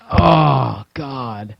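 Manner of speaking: sinking, defeated sigh of words; dejected groan. Retold or imitated. Player finding his shed all gone, deflated groan. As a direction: let it drop away softly at the end.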